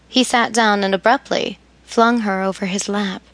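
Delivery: ordinary delivery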